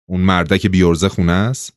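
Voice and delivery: ordinary delivery, nothing marked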